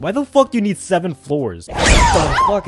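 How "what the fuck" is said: sudden high startled yelp; startled shriek. Real, not acted. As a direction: jump at the scare with a squeal. Startled reacting to movie scare.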